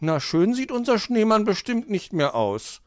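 put-on high child voice, touch of sorrow; sad. A narrator's reading. Hoppel mourns the spoilt snowman, mildly sad. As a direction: lament gently in a young, high voice. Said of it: "war Hoppel traurig".

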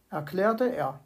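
usual speaking voice

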